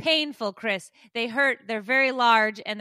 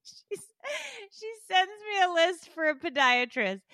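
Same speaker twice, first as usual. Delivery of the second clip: ordinary talk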